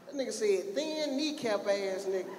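ordinary talk

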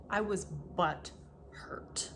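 slow whispered confession of "butthurt". Shown as a demonstration. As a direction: breathe it out slowly as a secret.

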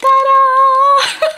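high, laughing ta-da reveal; sing-song ta-da. Real, not acted. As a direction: present the shoes like a magic trick. Reveals the new shoes.

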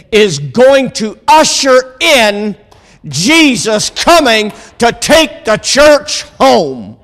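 high, slow, emphatic revival preaching; stretched-out pulpit proclamation; building preaching. Real, not acted. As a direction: build phrase by phrase to a ringing climax.

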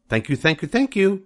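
proud goose soaking up congratulations; fussy goose. Acted. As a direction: bob and bow through every thank you. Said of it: "nodding and bowing shamelessly".

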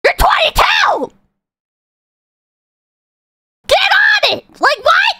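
high-pitched, exasperated shouting; shrieked scolding; incredulous yelling. Real, not acted. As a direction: yell in disbelief at a whiner. Yelling at imagined complainers in rant.

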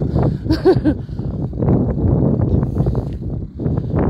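short unvoiced laugh; breathy laugh. Real, not acted. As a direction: laugh under your breath. Nervous laugh in the cold.

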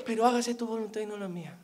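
quick, low, soft mumbled aside; very quiet. Acted. Believer hopes God won't hear this part, very soft. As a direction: rush it softly, hoping not to be heard. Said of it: "esa parte la dicen bien flojito".

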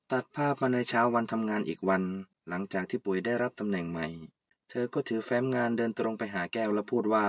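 low, soft narrator voice setting the scene; story narration. A narrator's reading.

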